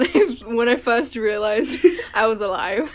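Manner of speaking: giggling through the words; laughing. Real, not acted. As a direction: talk while breaking into laughter. Laughs at her own joke.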